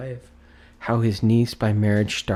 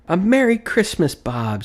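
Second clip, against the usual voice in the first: loud, high hearty cheer; earnest hearty greeting; 10 semitones higher, 6 dB louder than usual. Acted. Scrooge cheers Bob with a loud, high Christmas greeting. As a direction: wish him joy with all your heart. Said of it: "with an earnestness".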